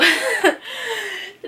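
high, real laugh of delight. Real, not acted. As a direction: burst into a high-pitched laugh. Delight at the gift.

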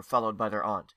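ordinary delivery

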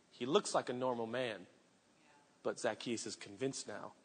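low, soft and reverent; hushed awe. Acted. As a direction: lean in with wonder.

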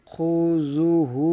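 খুজুহু chanted slowly, each syllable drawn; slow Quranic reading; slow recitation. Shown as a demonstration. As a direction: recite খুজুহু with measured length. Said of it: "এক আলিফ টানতে হবে".

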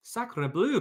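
a theatrical French outburst; cartoon French accent on Sacre bleu; French duck. A narrator's reading. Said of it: "cried the, the duck".

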